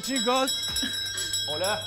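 ordinary delivery

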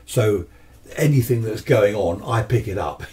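usual speaking voice

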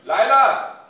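loud call across the office; shouting a name. Acted. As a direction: bellow for the assistant. Boss yells for assistant.

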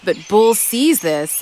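ordinary delivery

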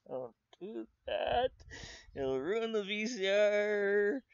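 high, pleading cry with a laugh; pleading with Spike. Retold or imitated. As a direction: beg him to stop, voice up high.

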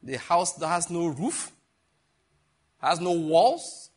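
slow, weighty preaching stress; emphatic preaching. Real, not acted. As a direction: drag it out slowly for weight. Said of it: "as a servant of God".

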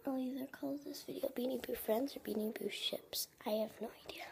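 soft whispered thinking aloud; deciding on the video title in a whisper. Real, not acted. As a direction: whisper it as if someone is close by. Whispering while grandma is nearby.

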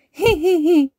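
high, loud hee-hee giggle; toddler giggle. A narrator's reading. George delighted by his part in the story, giggling. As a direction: giggle like a tickled small child. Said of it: "giggled George".

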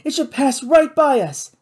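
high, loud, breathless thrill; breathless excitement. A narrator's reading. Kate tracks the creature coming past, breathless and high. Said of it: "said Kate breathlessly".

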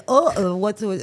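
loud, high mock bafflement; exaggerated imitation of a puzzled listener; mocking the complainer. Guest imitating host's reaction to the accent, mocking. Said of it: "Yeah, you complain".

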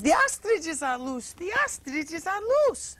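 put-on high, loud, drawn-out French accent; French-accented mother. Son imitates mother's alarmed news, high French accent. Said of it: "with a French mother".

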